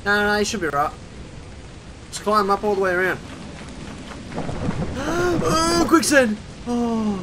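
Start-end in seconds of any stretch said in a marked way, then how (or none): 3.2-5.4 high breathless gasp of surprise; sharp gasp. Real, not acted. Spots quicksand suddenly. 5.4-7.2 high, startled realization; surprised. Real, not acted. Spots quicksand suddenly.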